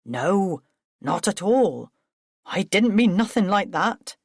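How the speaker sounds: Billy, alarmed and defensive; flustered denial. Acted. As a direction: hastily take it back. Billy backpedals.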